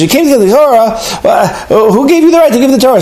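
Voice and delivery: high, fast, incredulous angel voice; high, fast outraged challenge; indignant angel. Retold or imitated. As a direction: scoff at him like an outraged angel. Angel challenges Moshe again.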